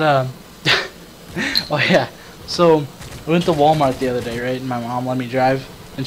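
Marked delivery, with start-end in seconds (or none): none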